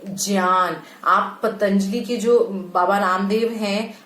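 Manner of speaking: ordinary talk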